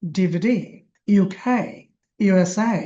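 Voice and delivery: final letter stressed in DVD, UK, USA; stress on last letter. Shown as a demonstration. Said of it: "last অক্ষরটা জোর দিতে হয়".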